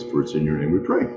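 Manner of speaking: ordinary talk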